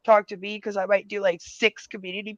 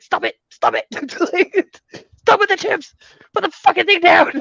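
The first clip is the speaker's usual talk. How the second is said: half-laughing mock yell, louder and higher; 12 semitones higher, 9 dB louder than usual. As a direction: yell it at yourself like a friend grabbing the chips. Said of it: "I'm like".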